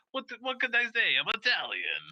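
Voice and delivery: hint of a New York Italian accent; mock New York Italian accent. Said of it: "Italian accent".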